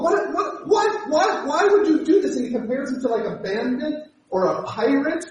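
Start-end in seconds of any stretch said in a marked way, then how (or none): 0.0-2.4 high, stammering mock outrage at Jacob; Laban accusing. Father-in-law Laban demands why Jacob did this, sputtering high. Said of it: "he's saying to his son-in-law".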